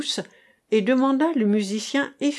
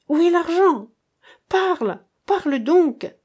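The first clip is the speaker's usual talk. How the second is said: high, loud demanding shout; angry mother shouting at her child; furious mother; 9 semitones higher, 5 dB louder than usual. A narrator's reading. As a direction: force the child to answer. Mother interrogating her daughter.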